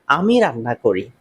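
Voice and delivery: ordinary delivery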